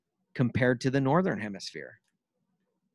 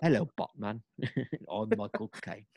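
soft, lightly voiced Michael Caine in a British accent; hint of Michael Caine, trailing off into a laugh; mock British Michael Caine; Michael Caine impression; 3 semitones lower, 6 dB quieter than usual. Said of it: "Sounds like Michael, uh, Michael Caine".

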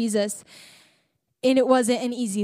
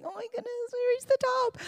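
much higher, fast, thrilled squeal; breathless summit excitement, squealed high and fast; excited squeal; 12 semitones higher, 7 dB quieter, faster than usual. Retold or imitated. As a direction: replay the summit-top thrill. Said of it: "did you hear how excited I was?".

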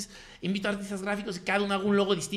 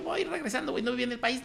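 excited reply; 7 semitones higher than usual. Retold or imitated. Answering friend at concert.

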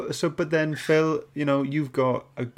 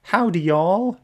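put-on Southern American accent; 7 semitones higher, 5 dB louder, slower than usual. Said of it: "I can't do that accent".

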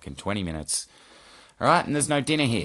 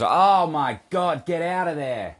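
high, loud, drawn-out outburst; exasperated yell; 9 semitones higher, 7 dB louder, slower than usual. Real, not acted. Host shooing the cat off the desk, exasperated yell. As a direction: shoo the cat away, fed up.

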